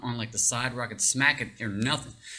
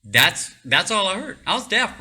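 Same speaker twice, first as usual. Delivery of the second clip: higher, louder disbelief; incredulous; 8 semitones higher, 6 dB louder than usual. Real, not acted. Telling how the blast left him deaf, incredulous. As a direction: stress how unbelievable it was. Stunned by deafness after blast.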